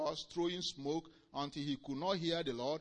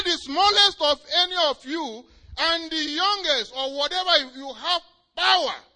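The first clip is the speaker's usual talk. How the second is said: high, loud proclamation, slowing at the end; Jesus proclaiming; 13 semitones higher, 17 dB louder than usual. Retold or imitated. Jesus telling Hagin about his power, proclaiming. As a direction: declare the authority handed over. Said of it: "He said".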